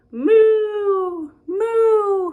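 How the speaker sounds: high, loud playful mooing; cow moo. Acted. Reading to kids, mooing like a cow, high and loud. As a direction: moo big for the children. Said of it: "Who says moo?".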